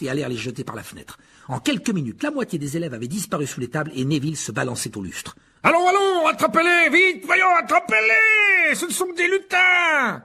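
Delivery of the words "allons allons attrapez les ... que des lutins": high, much louder frantic shouting; panicked yell. A narrator's reading. Lockhart yelling at pupils amid the pixie chaos, panicked shout. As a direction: scream orders as chaos erupts. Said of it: "hurla Lockhart".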